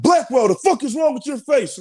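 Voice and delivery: very high, imitated drill sergeant confrontation; Drill Sergeant Walsh. Drill sergeant to crying recruit at attention, imitated harsh challenge. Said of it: "Drill Sergeant Walsh comes up to me".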